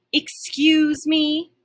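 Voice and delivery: slow, drawn-out offended growl; growly offended. A narrator's reading. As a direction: let it out slow and affronted. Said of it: "The golden gets all growly".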